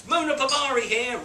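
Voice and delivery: the caller introducing himself, high and loud; caller's message. Retold or imitated. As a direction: put on an eager stranger's voice.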